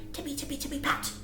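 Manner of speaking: soft whisper of tiptoeing bunnies; whispered sneaking. A narrator's reading. Reading bunny footsteps to children in a whisper.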